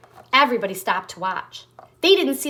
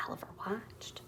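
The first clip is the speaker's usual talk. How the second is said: whisper; 20 dB quieter than usual. A narrator's reading. Hushed storytelling beat.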